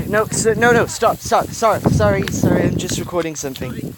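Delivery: real high-pitched flustered apology. Real, not acted. Reader interrupted while recording, flustered.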